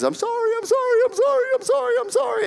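very high-pitched child impression; imitating apologetic kids. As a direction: squeal out hollow apologies like a caught kid. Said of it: "Your kids".

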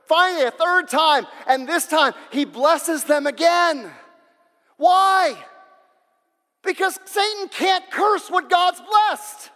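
high, loud sermon drive; fiery preaching. Acted. Preacher warning the congregation about disobedience, fiery preaching. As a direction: preach it hard at the crowd.

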